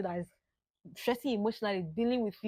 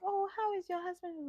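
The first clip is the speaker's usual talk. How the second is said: cheerful question; 9 semitones higher than usual. Retold or imitated.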